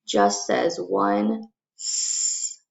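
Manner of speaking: slow "one S" with a hissed s; single s sound. Shown as a demonstration. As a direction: draw out "one S" as a single hiss. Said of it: "our double consonant".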